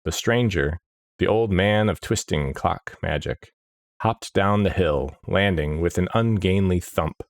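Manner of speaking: ordinary delivery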